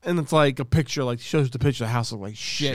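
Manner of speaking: ordinary delivery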